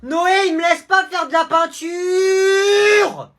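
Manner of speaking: crie très aigu et lent, en pleurnichant; plainte d'enfant. Acted. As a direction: se plaint en traînant les mots. Enfant se plaint à maman. (petit frère qui rapporte à sa mère en criant)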